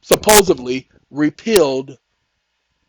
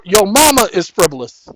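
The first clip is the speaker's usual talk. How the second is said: loud retort; 3 semitones higher, 8 dB louder than usual. Pretend. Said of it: "You tell the judge straight out in open court".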